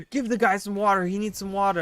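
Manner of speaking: mock nagging chat; quoting chat. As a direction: call out like a nagging viewer. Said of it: "people kept on saying".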